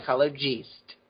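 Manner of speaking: psychologist ending in a stressed "jeest"; corrective pronunciation. Shown as a demonstration. Author explains it's spelled with an E, corrective pronunciation. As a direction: say psychologist with "jeest" for the last syllable. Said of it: "It's pronounced psychologist".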